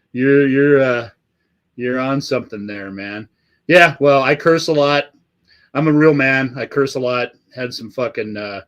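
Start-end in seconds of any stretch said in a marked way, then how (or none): none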